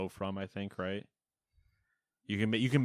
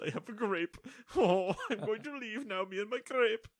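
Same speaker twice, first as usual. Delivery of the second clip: much higher, squeaky greedy raccoon voice; raccoon accent; 12 semitones higher than usual. Acted. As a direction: play a smug little raccoon hugging its grape. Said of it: "why that accent came out for the raccoon".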